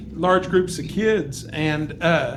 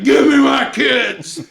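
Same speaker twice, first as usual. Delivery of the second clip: slow, raised mock yell; going crazy; 4 semitones higher, 4 dB louder, much slower than usual. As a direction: re-enact a frantic parent demanding the kids. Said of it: "I just went crazy on the guy".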